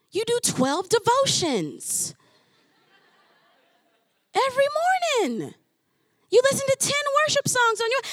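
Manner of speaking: God exasperated with a believer who claims not to hear, loud incredulity. Acted. Voicing God's exasperated reply.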